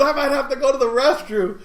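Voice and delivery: high, loud mock distress; joking panic. Shaken passenger after a wild car ride, joking panic. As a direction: cry out in comic urgency. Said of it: "of course he was joking around".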